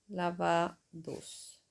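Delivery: Lavados drawn out softly as a wrong form; teacher sounding out Lavados, then rejecting it; slow, doubtful. Shown as a demonstration. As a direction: test Lavados slowly, unsure. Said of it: "Pero esto no existe".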